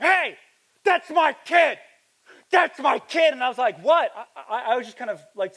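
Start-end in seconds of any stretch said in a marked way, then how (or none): 0.0-3.3 high, loud imitated roar; angry yell. Enraged father confronting the driver, imitated angry shouting. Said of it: "he's just angry". 3.8-4.1 high, loud mock bewilderment; startled reply. Preacher replaying his reply to the angry stranger, startled exclamation.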